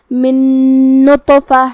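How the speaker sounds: من runs into نطفہ on a long hummed n; merged nasal n. Shown as a demonstration. Teacher showing ghunna, long nasal hum from من into نطفہ. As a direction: hold the doubled n joining من and نطفہ. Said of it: "دوسرے نون میں ملاتے ہوئے غنہ".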